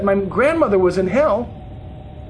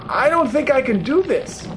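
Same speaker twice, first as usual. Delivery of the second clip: higher-pitched dread, at normal volume; frightened pleading; 6 semitones higher than usual. A narrator's reading. Pleading with Jesus before the pit of fire, frightened. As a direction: balk in fear, voice rising.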